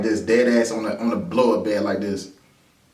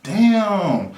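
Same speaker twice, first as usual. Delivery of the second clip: high, loud startled damn; pitched-up loud shock; surprised; 10 semitones higher, 4 dB louder than usual. Retold or imitated. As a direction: caught off guard, suddenly in the line of fire. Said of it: "I was just like".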